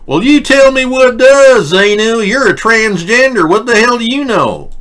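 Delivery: put-on Southern accent pushed up high. Host answering the cohost in a mock Southern accent. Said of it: "that doesn't sound like a Southern accent".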